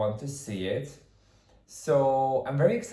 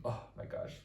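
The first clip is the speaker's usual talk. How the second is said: breathless whisper; 11 dB quieter than usual. Real, not acted. Awed at the castle.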